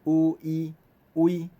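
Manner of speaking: 有伊威 said slowly, 有 then 伊 merging into 威; teacher stretching 有伊威 to show the glide; slow blend. Shown as a demonstration. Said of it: "连做伙念较紧的".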